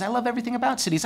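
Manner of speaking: ordinary talk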